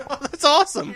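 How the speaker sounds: high, loud laughing delight; amused laughing. Real, not acted. Co-host reacting to the throw-up gag, laughing. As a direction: burst out laughing through the words.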